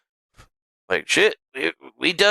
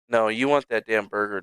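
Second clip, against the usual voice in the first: ordinary delivery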